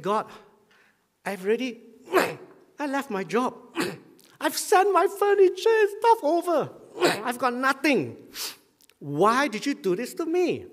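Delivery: desperate retold prayer, voice pitched high; tearful pleading. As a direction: pour out the hurt, pleading. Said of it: "I went back and I cried".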